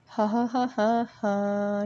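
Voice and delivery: drawn-out, deadpan fake ha-ha; sarcastic mock laugh. Real, not acted. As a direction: stretch it out with mock amusement. Mock laugh at her own mishap.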